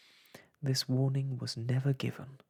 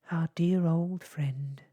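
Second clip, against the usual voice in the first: louder, slow and sobbing; 4 semitones higher, 6 dB louder, slower than usual. A narrator's reading. Cuddlepie mourning the lost friend, slow and tearful. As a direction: draw it out as if through tears. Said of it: "sobbed Cuddlepie".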